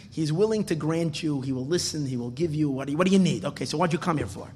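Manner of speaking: normal talking voice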